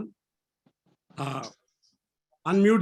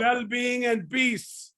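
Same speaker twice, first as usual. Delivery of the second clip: slow, raised, drawn-out phrase for repeating; mantra call; 4 semitones higher, slower than usual. Shown as a demonstration. Teacher feeding the class a mantra, slow high call. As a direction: stretch each word for the class to echo. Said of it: "repeat after me".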